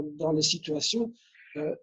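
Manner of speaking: ordinary talk, nothing marked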